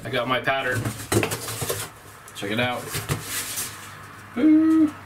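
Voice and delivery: normal talking voice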